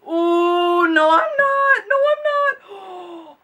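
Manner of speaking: high, loud squealing no, I'm not; squeal, then stretch the second one out slowly; excited squeal. Real, not acted. Overwhelmed by the palette.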